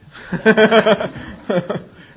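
high, loud burst of real laughter. Real, not acted. Laughing at his own un-Al-Anon attitude, loud real laugh. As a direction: break up laughing at yourself.

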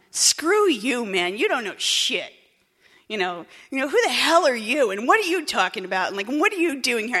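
snotty brat attitude. Retold or imitated. Young newcomer mouthing off at the old-timer, bratty and loud. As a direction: sneer at him like a Jersey punk chick. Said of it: "giving this attitude ... snotty brat, punk chick attitude".

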